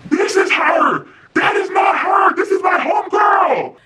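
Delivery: high, slow strained shout; exasperated shouting. Retold or imitated. Man showing her the ex's picture, exasperated shouting. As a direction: shout each sentence out to prove it.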